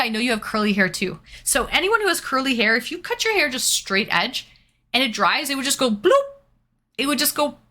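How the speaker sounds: usual speaking voice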